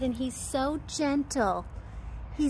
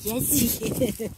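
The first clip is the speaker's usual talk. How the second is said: ordinary talk, nothing marked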